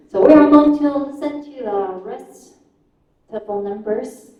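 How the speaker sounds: normal talking voice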